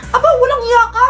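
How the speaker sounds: indignant teacher. Acted. Teacher insulted by student.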